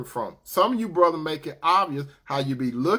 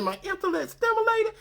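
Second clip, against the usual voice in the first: high amused woman. Retold or imitated. Woman amused by his line.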